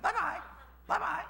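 very high squawky parrot voice; parrot imitation. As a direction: mimic a talking bird in tiny, high-pitched bursts. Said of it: "And the bird goes".

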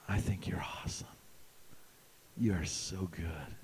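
breathy low whisper; seductive whisper.

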